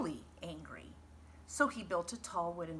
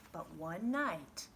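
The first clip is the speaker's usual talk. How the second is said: ordinary delivery, nothing marked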